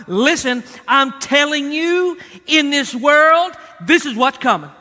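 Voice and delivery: loud, insistent pulpit delivery; emphatic preaching. Real, not acted. Preacher warning the congregation of coming trouble, emphatic preaching. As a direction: lean hard on the key words.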